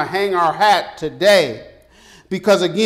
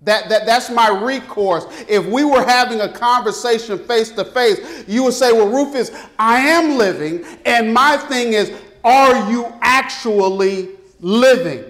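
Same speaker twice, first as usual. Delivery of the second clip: raised, ringing preacher's challenge; booming motivational speech; 3 semitones higher, 3 dB louder than usual. Acted. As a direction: push it out like a revival preacher. Preacher builds to full force.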